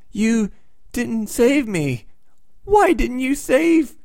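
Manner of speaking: high, slow, pitiful last words; a dying boy whining blame up high; dying Human Torch. Retold or imitated. To his sister while dying, high and slow.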